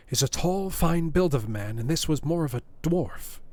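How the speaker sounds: plain reading voice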